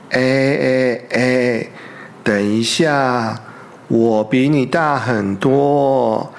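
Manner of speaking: a big bear insisting he is hungrier, low and slow; indignant big bear. Acted. Bear argues with the weasel over mushrooms, low slow and indignant. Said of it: "大熊理直气壮地说".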